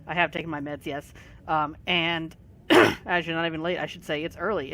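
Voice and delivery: ordinary delivery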